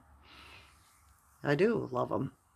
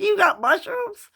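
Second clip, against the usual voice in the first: high, loud, drawn-out excited shriek; excited yell; 11 dB louder than usual. Retold or imitated. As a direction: yell it in disbelief. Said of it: "You don't have to yell, Mom".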